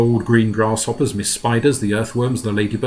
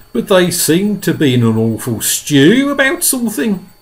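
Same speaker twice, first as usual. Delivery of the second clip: higher, louder creature voice; centipede's voice; 8 semitones higher, 5 dB louder than usual. Acted. Centipede answering the grasshopper, high character voice. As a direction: lift the voice high and loud in character.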